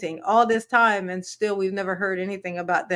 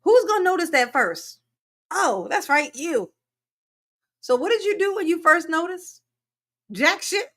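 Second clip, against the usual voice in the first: sarcastic mocking; 7 semitones higher than usual. Mocking the caller.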